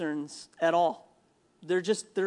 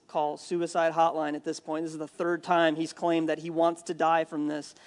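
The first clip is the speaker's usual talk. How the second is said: normal talking voice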